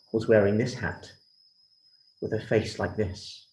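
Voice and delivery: ordinary delivery, nothing marked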